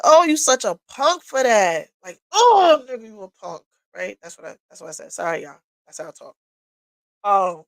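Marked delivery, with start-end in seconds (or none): 0.0-1.9 loud, higher retold outburst; indignant retort. Woman retells her comeback to her father, loud and indignant. 2.3-3.6 indignant retort. Retold or imitated. Woman retells scoffing at her father's note, indignant outburst fading.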